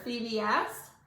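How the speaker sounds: usual speaking voice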